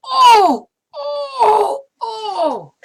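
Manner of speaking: high wailing cry; high teary oh oh oh; mock wailing. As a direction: imitate her sobbing in the car. Woman crying in her car.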